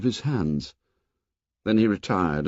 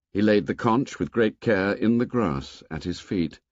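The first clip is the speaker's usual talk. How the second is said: ordinary delivery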